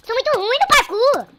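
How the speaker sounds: high, very loud wail of self-disgust; frustrated shout. Real, not acted. Player keeps failing the parkour, shouts at himself. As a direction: scream it at the screen. Raging at own failure.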